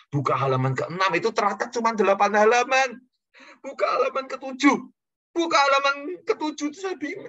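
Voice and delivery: loud, high, flustered retelling; mounting panic. Retold or imitated. Preacher recalling a failing outreach visit, mounting panic. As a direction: build into exasperated, high-pitched panic.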